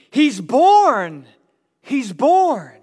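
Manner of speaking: loud, high jubilant shout; exultant scream. Acted. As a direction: shout the news out as if unable to hold it in. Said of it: "they couldn't stop screaming".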